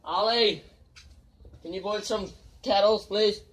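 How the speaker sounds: loud, high call across the yard. Real, not acted. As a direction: shout so Ollie hears from inside. Calling out to Ollie.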